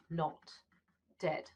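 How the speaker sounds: ordinary delivery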